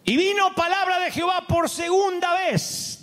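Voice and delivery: full-force pulpit shout; preaching shout. Acted. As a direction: cry it out to the whole church. Preacher booming the scripture line.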